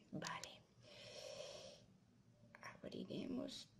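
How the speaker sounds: very soft, whispery narration; hushed suspense. Pretend. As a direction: whisper carefully, step by step. Carefully opening the wolf's belly.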